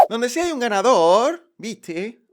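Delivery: put-on loud, slow Argentine accent; loud, slow mock Argentine accent. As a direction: announce it like a porteño showman. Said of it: "perdóname, que hoy me ha dado por el argentino".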